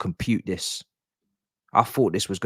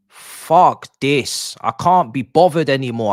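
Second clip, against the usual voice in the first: loud, higher, furious at the match; angry outburst; 6 semitones higher, 9 dB louder, slower than usual. Real, not acted. Fan losing it at his own team, furious shout. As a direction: blow up in frustration at the players.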